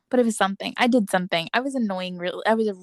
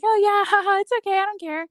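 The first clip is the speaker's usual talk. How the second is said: fake-cheery brush-off; 9 semitones higher, 6 dB louder than usual. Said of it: "And I'm like".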